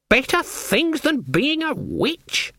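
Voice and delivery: high, slow, disbelieving challenge; scornful old witch. Acted. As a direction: draw it out high as a baited question. Granny challenges Magrat, voiced.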